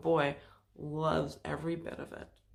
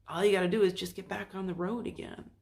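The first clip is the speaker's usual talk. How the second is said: usual speaking voice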